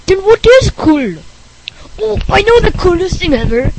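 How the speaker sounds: put-on high, loud eager kid; Rick Berner voice. Rick boasting he knows something cooler, high and eager. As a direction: pipe up excitedly.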